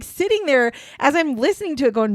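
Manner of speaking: ordinary talk, nothing marked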